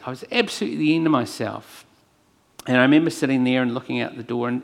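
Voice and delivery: ordinary talk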